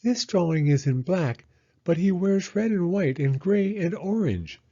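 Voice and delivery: high, earnest hedgehog; Hedgehog's voice. Acted. Hedgehog explaining Muddy's colors to Owl, high and earnest. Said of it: "Hedgehog explained".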